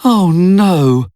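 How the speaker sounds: high, dismayed exclamation. Acted. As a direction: cry out in dismay at bad news. Reacting to news of grave illness.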